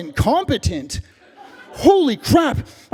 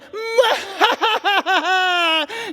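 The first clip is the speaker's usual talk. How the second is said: put-on childish high-pitched giggle; sneaky little boy's gleeful giggle; 10 semitones higher than usual. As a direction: giggle like a kid hiding.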